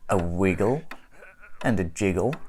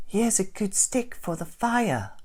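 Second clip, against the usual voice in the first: other voice; 11 semitones higher than usual. Acted. Unseen voice by fireplace.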